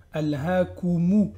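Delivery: Alhaakumu with a clear u vowel; Alhaakumu, u fully voiced; clear dhamma. Shown as a demonstration. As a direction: stretch the u in Alhaakumu. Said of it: "j'ai bien prononcé la dhamma".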